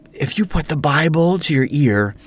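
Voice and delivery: usual speaking voice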